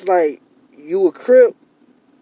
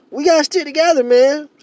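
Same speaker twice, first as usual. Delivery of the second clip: higher and louder, pleading with feeling; passionate raised plea; 8 semitones higher, 6 dB louder than usual. Real, not acted. Vlogger pleads with his community to unite, voice raised. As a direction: urge the listener hard to stick together.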